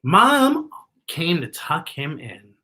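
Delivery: ordinary delivery, nothing marked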